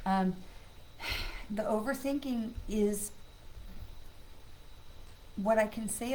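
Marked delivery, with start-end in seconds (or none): 0.4-1.5 breathy unvoiced sigh; soft sigh. Real, not acted. Pausing before talking about overthinking, soft sigh. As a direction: let out a quiet tired sigh. Self-soothing while talking anxiety.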